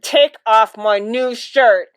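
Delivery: loud, slow, emphatic order; angry demand. Acted. Father wants his shirt back from mother, angry demand. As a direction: order it word by word. Acted quarrel over a shirt.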